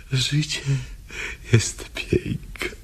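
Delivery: a touch of a broken sob on piękne; mild tearful whisper. Acted. Woman telling the commissioner life is beautiful, mildly tearful. First of three takes.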